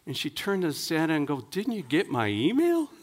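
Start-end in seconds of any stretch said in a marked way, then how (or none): 1.5-2.9 higher, mock-indignant child; lightly voiced incredulous little girl; horrified little girl. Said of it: "open-mouthed and horrified".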